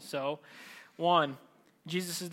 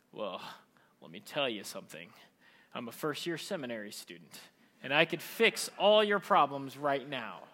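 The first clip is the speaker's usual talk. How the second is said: self-righteous moralizer; 5 semitones lower than usual. Acted. Preacher mocks a first-year seminary student, pompous low voice. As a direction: lecture down low and self-importantly, building to the boast. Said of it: "like, the greatest moral teacher in the world".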